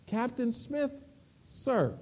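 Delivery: soft, measured address to a superior; chilling reply. Acted. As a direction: drop to a quiet, pointed address with pauses.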